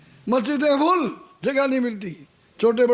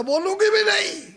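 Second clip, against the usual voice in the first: defiant retort; 9 semitones higher than usual. Acted. Rabia defies robber.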